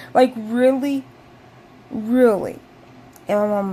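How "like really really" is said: outraged disbelief. Retold or imitated. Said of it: "And I was like".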